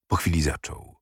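ordinary delivery

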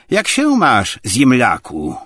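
loud, high-pitched brash hail; brash greeting. A narrator's reading. Tarantiew walks in without offering a hand, brash greeting. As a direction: greet him loudly and rudely. Rude visitor greets his host.

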